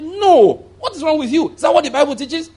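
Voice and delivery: high, exclaiming retold rebuke; incredulous rebuke. Pastor retells rebuking the woman, high and incredulous. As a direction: pitch it up in disbelief. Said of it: "I said".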